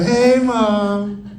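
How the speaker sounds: high, drawn-out innocent hello; guilty kid's high-pitched phone greeting; sheepish greeting. Retold or imitated. Sheepish greeting to angry mother on phone.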